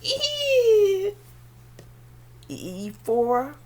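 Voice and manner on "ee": long high elephant squeal; imitated trumpeting on a held "ee".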